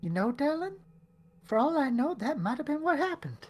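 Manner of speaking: high, sing-song character voice; [name] voice. Acted. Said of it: "be like".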